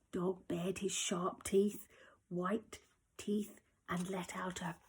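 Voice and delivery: slow hushed menace; menacing whisper. A narrator's reading. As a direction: whisper it like a threat. Menacing build to growl.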